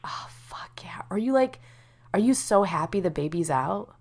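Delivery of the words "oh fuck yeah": whispered, breathless excitement. Real, not acted. Friend reacts to good news in a real whisper. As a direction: hush it out in glee.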